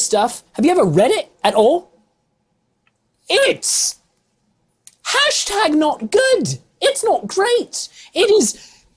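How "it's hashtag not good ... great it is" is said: much higher, slow exasperated whine; high, slow exasperated disgust. Real, not acted. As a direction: whine it in disbelief, drawn out. Ranting about de Vere's writing.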